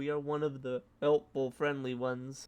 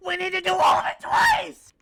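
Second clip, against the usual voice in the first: much higher, louder indignant shout; outraged shout; 14 dB louder than usual. Real, not acted. Streamer shouting at a doubled recipe, high and loud. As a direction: yell it at the recipe.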